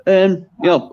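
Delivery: usual speaking voice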